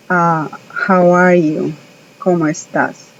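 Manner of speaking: usual speaking voice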